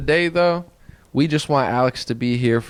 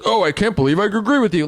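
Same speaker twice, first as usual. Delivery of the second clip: mocking imitation; 7 semitones higher than usual. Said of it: "he was like".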